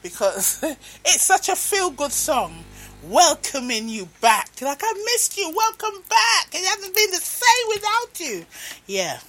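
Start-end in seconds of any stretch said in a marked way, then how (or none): 4.8-8.5 high-pitched, gushing mock welcome; joyful welcome. Spouse welcoming a partner back home, joyful. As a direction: act out a delighted homecoming greeting.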